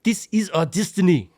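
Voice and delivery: a hint of Matt Damon's South African accent. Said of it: "Matt Damon's like".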